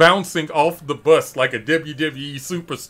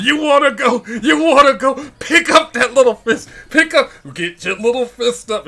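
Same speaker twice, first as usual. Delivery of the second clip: a touch of a taunting drill sergeant, higher and louder; lightly mock a drill instructor goading a recruit; 9 semitones higher, 4 dB louder than usual. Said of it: "this little gravelly drill instructor voice".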